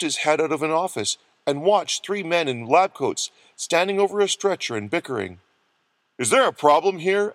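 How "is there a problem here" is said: higher, abrupt question. A narrator's reading. Doctor confronts bickering men in lab coats, abruptly. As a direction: cut in with an abrupt challenge. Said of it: "asked Doctor Freeman abruptly".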